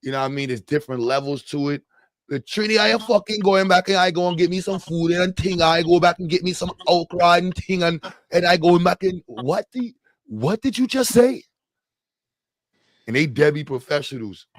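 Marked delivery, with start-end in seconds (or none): 2.3-9.2 higher-pitched Trinidadian patter; hammed-up Trini singsong, running on and on; mock Trini accent. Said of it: "that's how Trini sound". 9.2-11.4 high, incredulous question; pitched-up mock bewilderment; baffled disbelief. Acting baffled listener to the accent.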